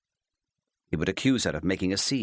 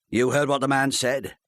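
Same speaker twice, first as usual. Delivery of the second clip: curt husband voice; lightly voiced husband; 5 semitones higher, 4 dB louder than usual. A narrator's reading. Husband dismissing his wife's question, mildly curt. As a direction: brush her off, a touch impatient.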